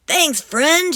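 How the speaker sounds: high, loud thanks; grateful yell. Acted. Captain yelling thanks to the sea monster, high and loud. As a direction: call your thanks out across the water. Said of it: "yells Captain Pete".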